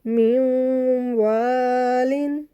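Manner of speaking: मिन वालिन chanted very slowly with the नून hummed through the nose; slow tajweed recitation with nasal hold; nasal ghunna. Shown as a demonstration. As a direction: hold the nasal sound on वालिन for two counts. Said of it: "वाव मुशद्दद पे भी nasal sound बनानी है".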